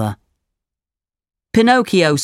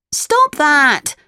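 put-on high, sharp puppet boy cry; indignant protest; 11 semitones higher than usual. A narrator's reading. Pinocchio scolds the naughty boy, indignant high cry. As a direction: cry out at a bully, pitched way up.

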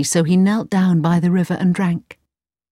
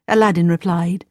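plain reading voice

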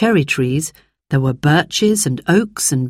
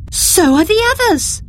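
much higher, excited exclamation; exclaimed. A narrator's reading. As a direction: gasp it out in amazement. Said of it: "exclaimed Roberta".

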